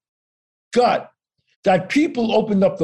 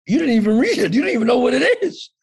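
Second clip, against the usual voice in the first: higher, astonished objection; incredulous protest; 7 semitones higher, faster than usual. Retold or imitated. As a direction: protest in amazement to a generous friend. Astonished at receiving the cheque.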